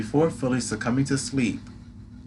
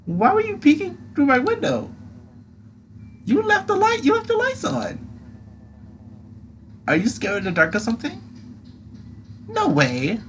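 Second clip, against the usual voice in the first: high squeaky moth creature; pixie; 4 dB louder than usual. Acted. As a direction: tease in a tiny squeaky voice. Squeaky Atlas voice.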